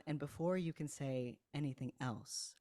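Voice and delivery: ordinary talk, nothing marked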